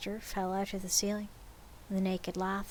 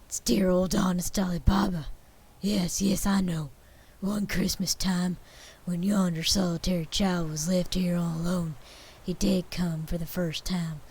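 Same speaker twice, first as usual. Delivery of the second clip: put-on loud, eager old man reliving childhood; excited old Scrooge; 6 dB louder than usual. A narrator's reading. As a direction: gush like a delighted old miser. Scrooge voiced, childhood memories.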